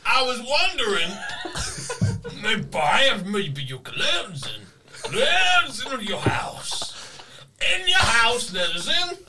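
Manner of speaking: high, loud, strained wrestler shout, laughing; Macho Man impression. Player on the intercom, hammed-up wrestler yell. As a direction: belt it out like a pro wrestler promo. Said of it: "doing, like, Macho Man Randy Savage".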